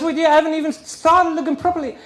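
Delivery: high, fast nag as the ex; quick, scolding mock ex; nagging ex. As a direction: rattle it off as the nagging ex. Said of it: "she says".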